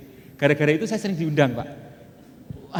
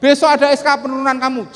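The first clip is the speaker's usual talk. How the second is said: threatening boss; 10 semitones higher, 11 dB louder than usual. Retold or imitated.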